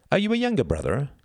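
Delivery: put-on high, merry boy's voice; high-pitched playful probing; sudden teasing question. A narrator's reading. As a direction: ask with sly, boyish glee. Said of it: "asked Reginald suddenly".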